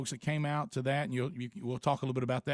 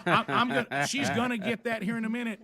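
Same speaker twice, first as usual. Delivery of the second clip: half-laughing, louder; 6 semitones higher, 4 dB louder than usual. Real, not acted. Teasing about his wife to friends, laughing. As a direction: chuckle through the words.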